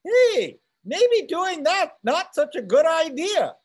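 high, loud cautioning conscience; Jiminy Cricket. Acted. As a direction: pipe up as a little nagging voice in the head. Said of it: "little Jiminy Cricket in your head that tells you".

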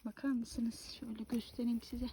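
hushed whisper, barely voiced. Real, not acted. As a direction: whisper it close to the mic. Showing the find, whispering.

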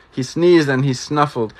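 ordinary delivery, nothing marked

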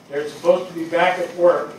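usual speaking voice

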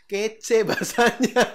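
high, slow, breaking into laughter; amused, dissolving into giggles; laughing. Real, not acted. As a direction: laugh through the words. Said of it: "Ya gitulah ya pokoknya ya".

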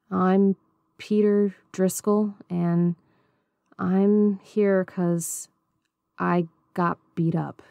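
slow, halting mumble with long gaps. Acted. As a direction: drag each word out, unsure. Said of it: "His speech was slow and halting".

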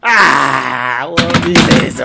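loud wailing cry; real despair at the crash; distressed shout. Real, not acted. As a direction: cry out in dismay. Computer crashing, crying out.

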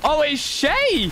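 high, drawn-out delighted cry; real squeal of recognition; excited recognition. Real, not acted. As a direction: stretch it out high as you spot them. Recognises a player, bursts out.

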